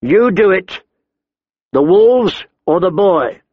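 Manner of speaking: high-pitched, forceful ultimatum; threatening demand. A narrator's reading. Big man making the brother choose, threatening. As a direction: force the choice on him. Hostage-taker forcing a choice.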